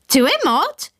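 high shrill parrot squawk with a rising end. Retold or imitated. Narrator mimics the parrot's squawk, high and rising. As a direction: squawk it like a parrot asking a question. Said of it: "it had squawked".

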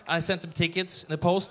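normal talking voice

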